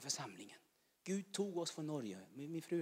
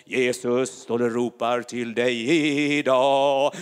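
the same call pushed louder; louder repeat; 2 semitones lower, 21 dB louder, slower than usual. Real, not acted. Preacher calling the listener, rising loud. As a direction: raise the call to the crowd.

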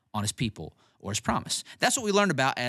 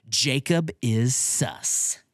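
"Jacob is sus" drawn out slowly; slow demo; much slower than usual. Shown as a demonstration. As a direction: spell it out slowly for kids. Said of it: "here's what I want you to say".

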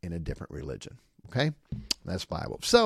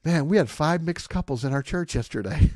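higher and louder, playful mock announcement; teasing assistant pastor; 5 semitones higher, 7 dB louder than usual. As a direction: rib the boss with a big reveal. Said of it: "He goes".

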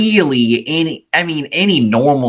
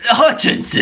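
mock sergeant bark; 11 semitones higher than usual. Sergeant calling out his name.

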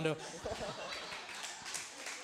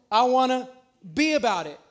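ordinary talk, nothing marked

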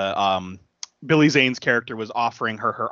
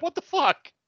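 mock disbelief. Room's reaction acted out.

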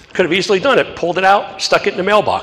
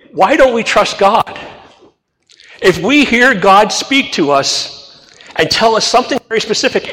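ordinary talk, nothing marked

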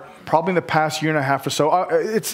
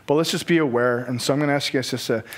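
usual speaking voice

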